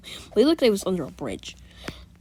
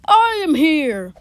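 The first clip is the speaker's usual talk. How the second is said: slow, loud heroic entrance, higher; All Might; 7 semitones higher, 8 dB louder, much slower than usual. Retold or imitated. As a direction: announce the hero's arrival grandly. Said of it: "All May would come out ... be like".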